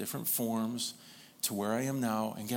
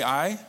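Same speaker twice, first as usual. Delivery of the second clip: "I" said louder with a lift; stress on I; 3 semitones higher, 6 dB louder than usual. Shown as a demonstration. Preacher prompting the congregation to say it, emphatic "I". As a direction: punch out the word "I". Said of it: "Say it with emphasis".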